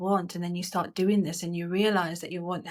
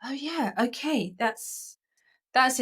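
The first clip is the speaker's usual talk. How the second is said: dawning realisation; 5 semitones higher, slower than usual. Acted. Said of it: "the moments that you thought".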